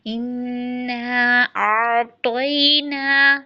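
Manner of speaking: तोई ना drawn slowly, ना stretched; tajweed demo. Shown as a demonstration. Teacher models correct ain and long ना. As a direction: sing out इन्ना आ तोई ना with slow stretched vowels. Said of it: "आइन की आवाज़ दुरुस्त रखें".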